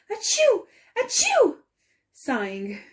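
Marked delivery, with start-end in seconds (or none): 0.1-1.6 two sudden high sneezes; sneezing. Acted. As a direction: sneeze hard, twice. Sneezes cut off her speech.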